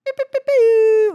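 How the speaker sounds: high sung beeps imitating an electronic sound; mock beeping. Music producer mimicking a sound for viewers, high beeps. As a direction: beep it out like a gadget.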